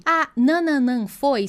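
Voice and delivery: slow, literal reading; drawn out, mechanical; slow flat demo. Shown as a demonstration. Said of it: "só a tradução de".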